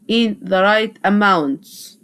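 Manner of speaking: "in the right amounts" drawn out slowly; slow teacher dictation. Shown as a demonstration. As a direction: read it slowly for students to copy. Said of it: "اسمها in the right amounts".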